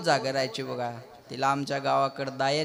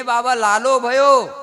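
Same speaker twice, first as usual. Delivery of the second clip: slow, loud, higher joyful announcement; joyful news; 9 semitones higher, 8 dB louder, slower than usual. Acted. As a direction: stretch out the good news with delight. Girl announces birth of a son. (आनंदाने, हळू आणि मोठ्याने बातमी सांगणे)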